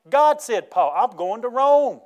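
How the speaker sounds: loud, high preaching, building to a drawn-out cry; triumphant declaration. Acted. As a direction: proclaim it with rising conviction. Preacher declaring Paul's resolve, building.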